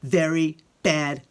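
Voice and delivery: put-on lively read-aloud voice for children; expressive picture-book reading; story narration. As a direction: tell it like Alexander grumbling through his day.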